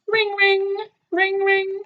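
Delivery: high sung-out ring ring like a phone. Acted. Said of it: "The video phone's ringing".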